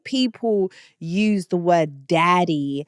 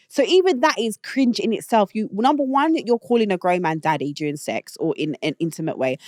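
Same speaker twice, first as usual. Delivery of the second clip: ordinary talk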